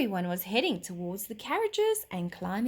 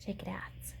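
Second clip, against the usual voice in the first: whisper; 11 dB quieter than usual. A narrator's reading. Child whispering to herself.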